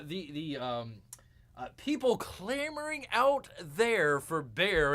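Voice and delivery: ordinary delivery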